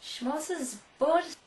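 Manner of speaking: Schmozzesbord drawn out slowly, mimicking a drunken slur; mock slurred smorgasbord. Cohost mocking John's mangled smorgasbord with a slow slur. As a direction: stretch Schmozzesbord like a tipsy echo. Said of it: "Have you been at the whiskey again".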